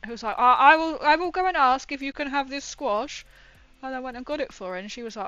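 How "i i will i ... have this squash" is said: loud, keen retold offer; eager helper. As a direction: imitate an obliging visitor, louder and higher. Acting out helpful visitor's offer.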